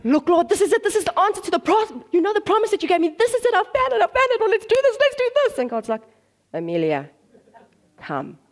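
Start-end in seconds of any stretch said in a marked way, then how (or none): 0.0-5.6 high, loud, breakneck enthusiasm; hammed-up overexcited pleading; frantically excited. Acts herself bolting after a find. 6.5-8.4 low, measured call with a pause; composed, much lower voice of God; calm command. Acted. God calmly calls her back.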